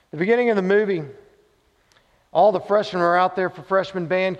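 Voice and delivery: lively storytelling. A narrator's reading. Retelling the movie scene with energy.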